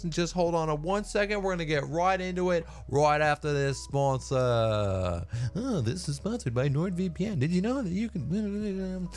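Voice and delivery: high, hyped-up YouTuber intro voice; mock YouTuber voice. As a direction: ham up a cheesy channel greeting. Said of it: "it was me making fun of, like, YouTubers".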